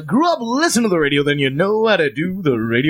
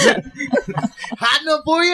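mock cheery; 11 semitones higher, much slower than usual. Mock weatherman question.

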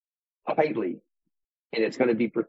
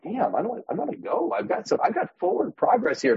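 inner voice mocked; 12 semitones higher than usual. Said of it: "the part of me is like".

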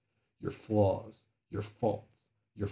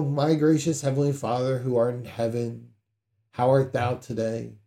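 mock flowery prayer; 9 dB louder than usual. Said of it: "you don't go".

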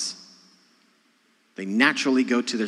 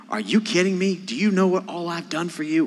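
exasperated parent; 6 semitones higher than usual. Retold or imitated. Exasperated parent voiced to kids.

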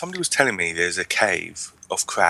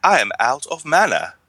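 mock Worgen accent; 7 semitones higher, 4 dB louder than usual. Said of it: "your Worgen speaks like that as well".

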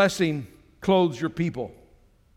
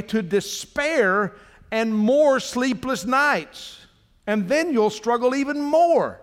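preaching with force; 5 semitones higher, slower than usual. Acted. Pastor warns the congregation against worrying, fervent preaching. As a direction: drive the point home to the church.

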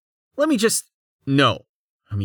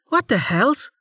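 much higher startled exclamation; 13 semitones higher than usual. A narrator's reading. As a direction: blurt it out in shock. Amelia startled.

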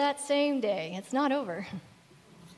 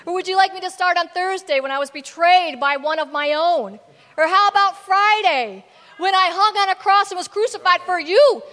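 mock-indignant sarcasm, building louder and higher; sarcastic; 4 semitones higher, 9 dB louder than usual. Preacher imagining Jesus answering Cleopas, sarcastic. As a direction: start dry, build to a loud, high rant. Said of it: "my sarcasm response would have been".

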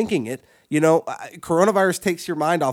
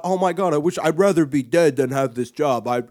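melodramatic complainer. Retold or imitated. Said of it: "you talk all that shit about".

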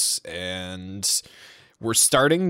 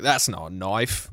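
put-on Australian accent; 3 semitones lower than usual. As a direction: put on a broad Australian accent, stretching the vowels. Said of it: "you're trying to do Australian".

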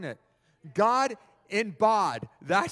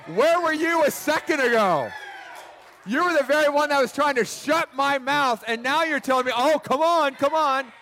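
loud, high acted protest; indignant outburst; 7 semitones higher, 7 dB louder than usual. Beggar confronts the crowd that hushed him, loudly indignant. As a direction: throw it back at them indignantly.

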